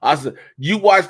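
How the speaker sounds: usual speaking voice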